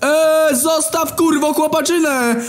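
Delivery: very high, strained mock yell; hollering. Caged detainees yelling at the policeman, high drawn-out holler. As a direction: holler it drawn-out from behind bars. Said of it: "drą mordę".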